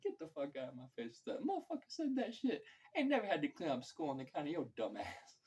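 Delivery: lightly mocking high outburst; imitating his old buddy. Old school buddy ranting, in a lightly voiced high mock outburst. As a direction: act out the old friend's rant. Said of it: "friend who would act just like that".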